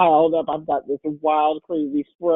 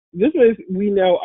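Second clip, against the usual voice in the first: ordinary talk, nothing marked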